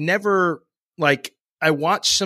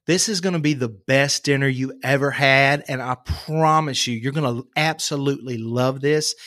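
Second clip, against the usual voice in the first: Georgia Southern accent; 2 semitones lower than usual. Shown as a demonstration. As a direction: play a Southern host, accent kept natural. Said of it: "Southern, when you go to Georgia, it's right here".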